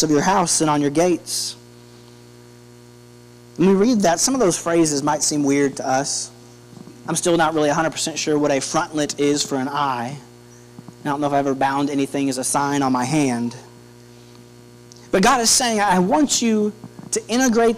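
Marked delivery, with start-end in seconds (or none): none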